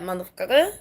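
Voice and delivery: ordinary talk, nothing marked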